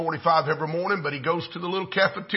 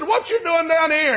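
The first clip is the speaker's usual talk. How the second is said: high-pitched mock bewilderment; retold incredulous question; astonished questioning; 10 semitones higher, 5 dB louder than usual. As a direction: act out a taken-aback demand. Astonished man questions the pastor.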